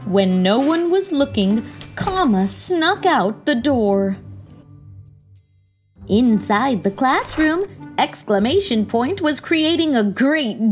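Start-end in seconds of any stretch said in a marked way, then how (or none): none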